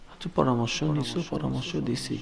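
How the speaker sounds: low, very soft questioning; meek protest. Acted. An angel questions God's order, meekly and quietly. Angel timidly answers God.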